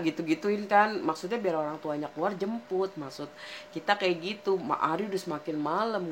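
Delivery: usual speaking voice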